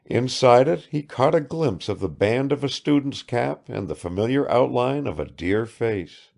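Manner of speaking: plain reading voice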